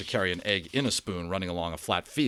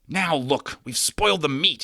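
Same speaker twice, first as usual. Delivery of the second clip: shouting; 7 semitones higher, 4 dB louder than usual. A narrator's reading. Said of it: "he shouted at Patrick".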